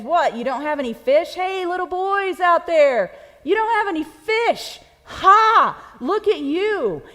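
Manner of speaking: high, loud mocking call with a laugh; Jesus playful taunt. Jesus calling from the shore to fishermen, playful mockery. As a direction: jeer at them like teenage friends. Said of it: "this is playful".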